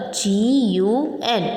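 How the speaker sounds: slow letter-by-letter spelling. Shown as a demonstration. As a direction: draw out each letter for pupils. Spelling out a word for pupils.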